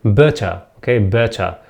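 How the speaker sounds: "better" British style, crisp t and no final r; British accent. Shown as a demonstration. English teacher shows learners the word in British accent. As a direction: say "better" the British way, ending in an open uh. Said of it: "In British accent, I think better".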